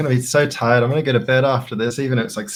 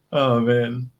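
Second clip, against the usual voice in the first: ordinary talk, nothing marked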